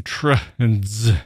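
"tr" and "z" sounded as bare consonants; isolated consonant sounds. Shown as a demonstration. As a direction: sound out "tr", then a buzzed "z", slowly. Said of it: "Where is the vowel in that word?".

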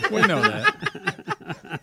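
high laughing words. Real, not acted. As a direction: laugh through it. Laughing at drummer.

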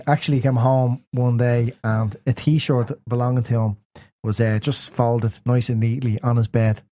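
ordinary delivery

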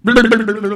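loud high laughing burble; goofy laughing noise; mock belly-shake noise. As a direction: imitate the belly jiggle.

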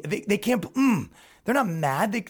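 ordinary talk, nothing marked

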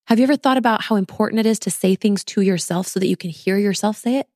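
usual speaking voice

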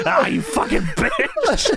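high, slow laughing screech; mock screech. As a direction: drag it out cracking up. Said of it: "heckler impression".

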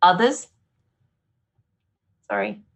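ordinary talk, nothing marked

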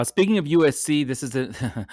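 ordinary talk, nothing marked

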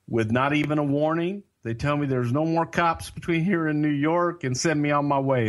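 ordinary delivery

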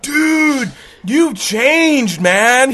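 loud, high surfer-dude exclamation; imitated bro astonishment; amazed surfer. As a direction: marvel at him like a surfer bro. Surfer amazed at old friend.

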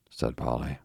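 ordinary delivery

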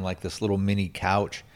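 ordinary delivery

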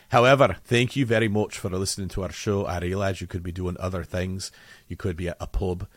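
lightly put-on Scottish accent, thanking listeners; a hint of a Scottish accent for St Patrick's Day. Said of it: "this is not an Irish accent, it's a Scottish one".

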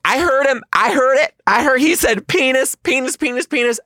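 put-on high, loud clamoring kid voices; imitated kids in a high-pitched uproar; excited classmates. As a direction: squeal it over and over like children. Imitating classmates erupting in chorus.

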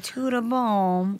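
a slow, lightly Brazilian "Tudo bom?"; Brazilian Portuguese greeting. Retold or imitated. Mimicking Buzios locals with a slow, lightly Brazilian greeting. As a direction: drawl "Tudo bom?" lazily like a beach local. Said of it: "everyone would be like".

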